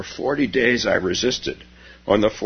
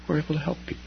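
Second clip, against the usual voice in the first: softer repeat; 8 semitones higher, 7 dB quieter, much faster than usual. Shown as a demonstration.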